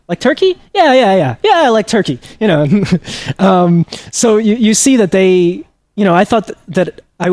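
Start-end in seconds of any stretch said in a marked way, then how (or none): 0.1-2.2 sudden high, incredulous "Like Turkey?" then eager yeahs; mock surprise. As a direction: play it as a wry, surprised double take. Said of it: "they look at each other like".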